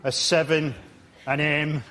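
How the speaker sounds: mock-bored, dropping away at the end. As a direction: read out the picks without caring. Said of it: "couldn't care less, half-hearted way".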